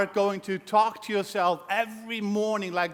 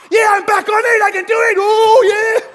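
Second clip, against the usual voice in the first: much higher and louder, triumphant; revived recruit; 11 semitones higher, 11 dB louder than usual. Retold or imitated. Recruit after sponsor's call, triumphant shout. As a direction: shout it back to life. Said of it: "the sponsor go".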